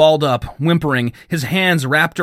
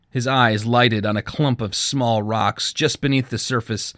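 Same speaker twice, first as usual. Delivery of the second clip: usual speaking voice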